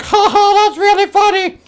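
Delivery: very high, loud cartoon voice; mock hilarity. Acting out the prankster's laugh at the gorilla, mock hilarity. Voicing character mocking the gorilla.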